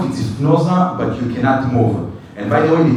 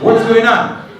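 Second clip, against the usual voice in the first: alarmed question; 9 semitones higher, 4 dB louder than usual. Retold or imitated. Host reacts to his panic.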